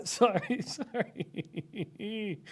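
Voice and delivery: apology dissolving into giggles; real giggling apology; laughing. Real, not acted. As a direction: fade into a quiet high laugh. Laughs after his loud outburst.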